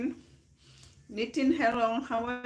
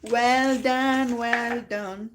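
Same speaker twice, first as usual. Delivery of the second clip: excited praise; 2 semitones higher, 7 dB louder, much slower than usual. Real, not acted. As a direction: cheer the viewer on. Congratulating viewer on exam.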